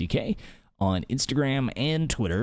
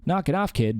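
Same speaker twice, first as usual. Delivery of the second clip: put-on higher, scolding yell at a kid; mock yell at a kid; 8 semitones higher than usual. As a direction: bark it like a grumpy adult scolding a boy. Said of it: "but you're yelling at him".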